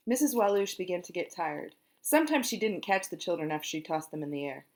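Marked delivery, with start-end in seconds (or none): none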